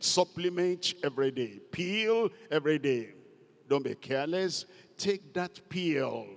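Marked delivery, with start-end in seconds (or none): none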